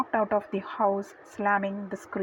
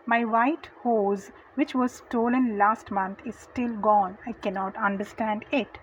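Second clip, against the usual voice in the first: ordinary delivery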